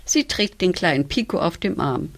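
usual speaking voice

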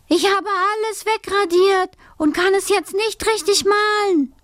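put-on high, insistent child's yell; girl shouting. A narrator's reading. Alice yells her trouble to deaf mother, high and insistent. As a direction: spell it out for deaf ears, high-pitched.

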